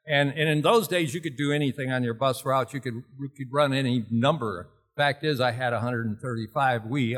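ordinary talk, nothing marked

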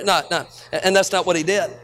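ordinary talk, nothing marked

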